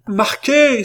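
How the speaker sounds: high, loud, ringing scream of defiance; cried out at full voice, far above normal pitch; savage cry. A narrator's reading. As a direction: shriek it out like a howl. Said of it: "s'écria-t-elle".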